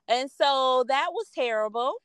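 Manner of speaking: normal talking voice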